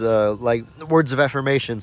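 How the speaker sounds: ordinary delivery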